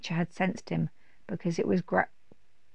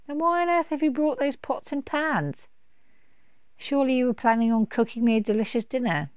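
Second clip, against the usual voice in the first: high, quick mocking dragon; teasing dragon voice; 6 semitones higher, 3 dB louder than usual. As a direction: tease him grandly. Dragon teases Kenny.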